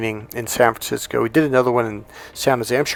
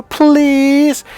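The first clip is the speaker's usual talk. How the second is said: put-on high little-girl begging; put-on pleading; 12 semitones higher, 4 dB louder than usual. Niece coaxing her aunt to buy cookies, imitated begging. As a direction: plead in a much higher, wheedling child's voice. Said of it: "that voice I taught you".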